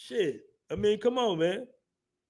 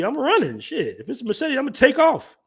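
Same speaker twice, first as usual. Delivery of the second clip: excited retort; 9 semitones higher than usual. Retold or imitated.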